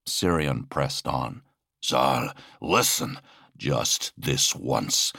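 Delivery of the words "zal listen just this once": low, slow appeal; earnest plea. Acted. Sirion begs his brother to listen, earnest and low. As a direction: plead slowly, pitched down.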